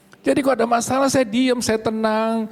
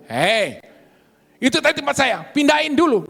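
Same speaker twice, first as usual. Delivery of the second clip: high, louder mock scolding demand; angry demand; 7 semitones higher, 4 dB louder than usual. Woman scolding the pastor in the pharmacy queue, loud high angry demand. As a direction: order him to move the car, rising in anger. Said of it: "Wih, marah-marah".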